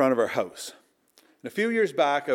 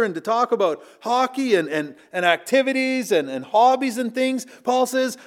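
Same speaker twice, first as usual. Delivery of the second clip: raised, louder preaching at full force; impassioned preaching; 9 semitones higher, 5 dB louder than usual. Acted. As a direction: preach it out with building fervour. Preacher quoting Paul, rising passion.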